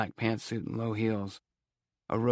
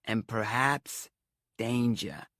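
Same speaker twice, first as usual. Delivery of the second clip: "perhaps" drawn out, a pause, then "danger"; slow, weighty "danger" after a dramatic pause; pause for emphasis; 2 semitones higher, much slower than usual. A narrator's reading. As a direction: hang on "perhaps" before dropping "danger" ominously. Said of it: "she paused, doing the old emphasis thing".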